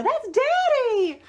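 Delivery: put-on high, slow little-girl voice; lightly voiced small child, drawn out; imitating her daughter. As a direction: mimic the toddler proudly pointing. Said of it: "every time she'd go".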